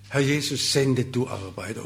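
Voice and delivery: normal talking voice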